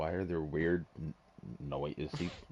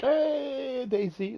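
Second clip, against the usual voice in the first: eager raised voice; excited high answer; 8 dB louder, much slower than usual. Retold or imitated. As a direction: sing it out eagerly. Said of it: "I'm like".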